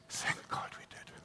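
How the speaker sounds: breathy whispered thanks. Real, not acted. Preacher whispering thanks to the congregation. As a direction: whisper it like a private thank-you. Grateful aside after laugh.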